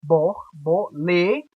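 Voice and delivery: "Borbolê" slowly, syllable by syllable, landing on lê; slow spaced "Borbolê", held on the last syllable; stress on LÊ. Shown as a demonstration. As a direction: stretch the final lê of "Borbolê". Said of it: "que é a sílaba mais forte".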